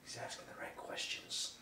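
whispered aside; whispering. Real, not acted. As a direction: murmur it without voice. Aside under breath.

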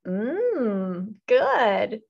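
drawn-out appreciative hum; savoring approval. A narrator's reading. Brother enjoying what Benny bought, savoring approval. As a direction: hum it like something tasty.

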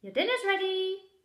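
high, loud call. Acted. Mother calling that dinner is ready, loud. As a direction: call across the house.

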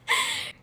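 quick high laugh; a short bright chuckle. Real, not acted. She laughs at her retort to her mother, quick and high.

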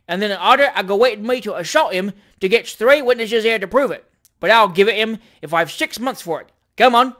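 loud, aggrieved cabman's rant; belligerent cab driver. A narrator's reading. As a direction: rant loudly, spoiling for a fight. Cab driver rants, wanting a fight.

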